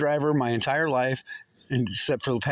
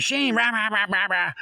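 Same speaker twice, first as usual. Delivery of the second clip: higher mock scolding babble; mocking a nag; 5 semitones higher than usual. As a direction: imitate her nagging at him. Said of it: "She was in my face".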